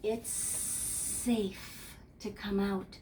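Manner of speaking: soft mama snake. Acted. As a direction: hush the babies out gently. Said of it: "The mama snake said".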